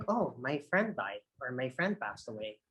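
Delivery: somber, low-key delivery; low energy. Shown as a demonstration. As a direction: break sad news quietly, voice dropped. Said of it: "Low energy".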